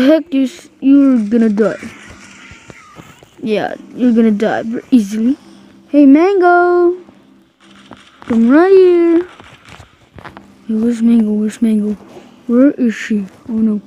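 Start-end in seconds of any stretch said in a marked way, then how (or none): 5.9-9.3 high, louder call to a pet; shouting across the map. Real, not acted. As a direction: call Mango over from afar. Calling a pet in game.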